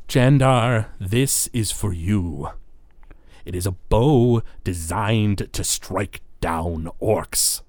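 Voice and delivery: slow, ceremonial gift-giving voice; Zalaman the Wretched. Acted. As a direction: present the gift with long, deliberate words. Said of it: "He says".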